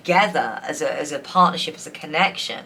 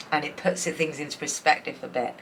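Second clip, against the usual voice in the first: usual speaking voice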